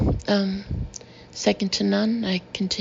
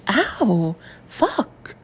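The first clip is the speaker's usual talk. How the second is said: pained yelp. A narrator's reading. Guy hit on the jaw, pained yelp. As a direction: cry out from the punch.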